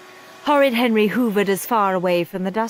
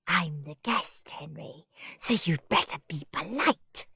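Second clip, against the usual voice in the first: soft whispered hiss; a quiet menacing whisper; 2 semitones lower, 7 dB quieter than usual. A narrator's reading. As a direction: hiss the threat under your breath. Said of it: "hissed Margaret".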